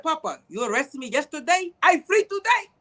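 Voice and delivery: put-on high, lilting Spanish-accented broken English; imitated Spanish accent. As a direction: play the freed man with a Spanish accent. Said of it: "I just use the accent".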